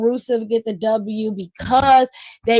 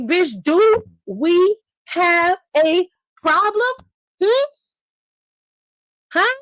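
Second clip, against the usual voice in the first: higher, slow, put-on menace; mock Rhea Ripley; 7 semitones higher, slower than usual. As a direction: lean in slowly with a pitched-up challenge. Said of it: "Rhea Ripley come on there like".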